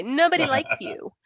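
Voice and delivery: mock fatherly jab with stress on NOBODY; imitating dad. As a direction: throw it out like her dad did. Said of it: "That's what Dad would say".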